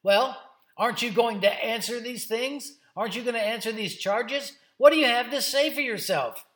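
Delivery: high, loud, demanding questions; bossy and indignant. Acted. High priest demanding answers from Jesus, bossy and indignant. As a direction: confront him with mounting indignation. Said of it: "real bossy acting and indignant".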